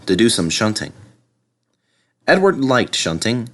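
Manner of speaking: ordinary delivery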